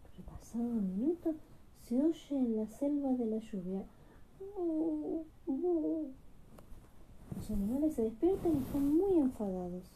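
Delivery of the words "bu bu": higher mock boo-hoo sobs; acted crying. Village boy crying at night, light mock sobbing. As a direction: sob lightly like a small child. A child cries in the story.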